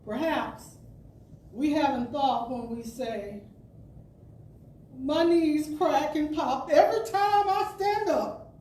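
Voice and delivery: ordinary talk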